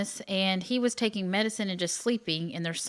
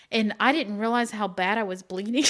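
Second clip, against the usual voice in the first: ordinary talk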